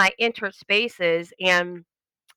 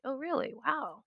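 mock amazement; 6 semitones higher, 12 dB quieter than usual. Sarcastic reply to praise.

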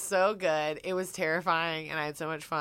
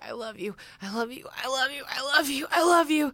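building intense declaration; 7 semitones higher than usual. Retold or imitated. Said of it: "he's talking about fucking this person".

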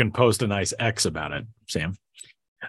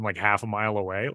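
ordinary talk, nothing marked

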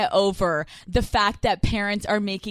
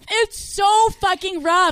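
frustrated shout; 8 semitones higher, slower than usual. Real, not acted. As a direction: stretch and shout the complaint. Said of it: "repeated louder".

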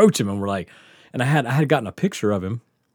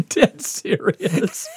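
usual speaking voice

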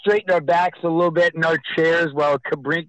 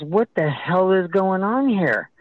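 higher, slow, indignant demand; irate official; 6 semitones higher, slower than usual. Retold or imitated. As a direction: retell an official's annoyed challenge. Retold angry parks official.

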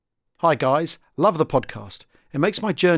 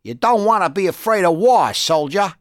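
put-on loud, higher New York accent; 4 semitones higher, 5 dB louder than usual. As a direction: bark it like a New York officer. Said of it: "put on his best New York accent".

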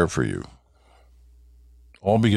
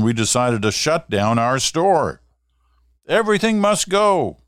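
ad read; 8 semitones higher than usual. Acted. Reads out a shop sale sign.

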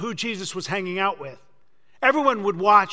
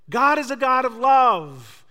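mock sweet preacher; 5 semitones higher, 5 dB louder than usual. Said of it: "expects Jesus to look at him and say".

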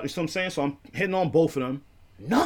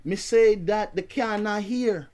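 higher, imitated Jamaican patois; terrible Jamaican accent; 5 semitones higher than usual. Neighbour puts the visitor right, terrible Jamaican accent. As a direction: explain it as a badly imitated Jamaican. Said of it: "This is a terrible accent".

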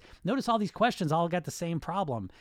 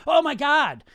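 shrill, loud scolding yell; mock furious outburst at yourself; harsh self-scolding; 12 semitones higher, 10 dB louder than usual. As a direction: scream at yourself for one wrong note. Berating yourself over wrong note.

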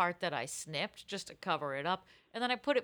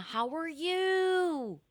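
Lisa Rinna; 9 semitones higher, much slower than usual. Retold or imitated.

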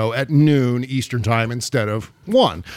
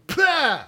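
spitting pah; 11 semitones higher than usual. Retold or imitated. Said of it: "I'm immediately going".

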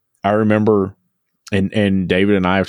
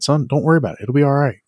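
a lightly voiced dad, higher and unhurried, soothing his son; calm reassuring dad; 8 semitones higher, faster than usual. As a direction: play a father calmly telling his worried son it'll be fine. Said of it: "And he son-, said".

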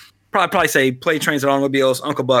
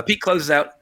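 normal talking voice

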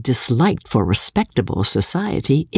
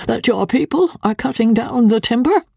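raised, higher pitch of protest; heightened voice; 10 semitones higher than usual. Acted. Son protesting to his father about the timber, voice raised. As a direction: press the point with a lifted voice. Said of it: "with a heightened voice".

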